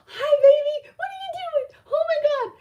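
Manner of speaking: put-on very high-pitched gushing baby talk; excited dog greeting. Greeting a friend's puppyish dog, squealing excitedly. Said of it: "I'm getting excited, and I just would".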